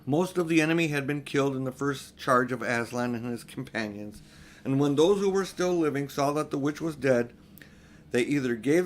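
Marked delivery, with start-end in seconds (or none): none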